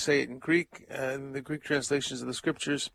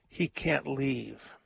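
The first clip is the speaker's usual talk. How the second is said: ordinary talk, nothing marked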